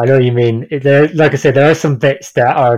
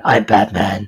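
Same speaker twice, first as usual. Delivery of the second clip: lower, softer take on the famous line; lightly gruff Batman; 5 semitones lower, 4 dB quieter, slower than usual. Retold or imitated. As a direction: growl it a little, Batman style.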